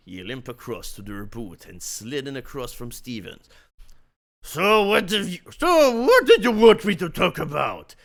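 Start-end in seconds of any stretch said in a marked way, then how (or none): 4.4-8.0 loud, higher mock grumble; grumbling. Bruce grumbling at Stevens, loud mock grumble. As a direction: grumble it at your business partner.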